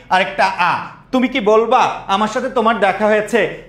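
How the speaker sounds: ordinary delivery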